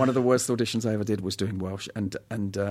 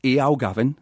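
demonstrated Newcastle greeting; Geordie accent. Shown as a demonstration. Actor showing the nan's phrase, Geordie accent. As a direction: greet Gavin in broad Geordie. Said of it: "the little phrase is".

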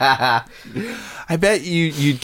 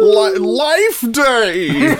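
announcer voice; 12 semitones higher, much slower than usual. Pretend. Life Day in announcer voice.

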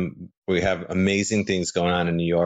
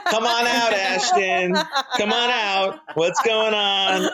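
high laughing call to a hidden guest; calling out playfully; 13 semitones higher, slower than usual. Pretend. Teasing the hosts, he calls for Ashton high and laughing. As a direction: sing it out, daring him to appear.

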